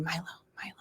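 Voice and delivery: whispering the name to oneself; imitated whispered practising. Parent mimics son rehearsing sibling's new name, whispered repetition. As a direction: whisper it like a child memorising.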